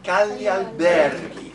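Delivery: slow, loud "alberghi", leaning on BER; stress on BER. Shown as a demonstration. Coach drilling the word accent with the group, stress on BER. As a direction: hit BER in "alberghi" hard. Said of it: "it's on ber".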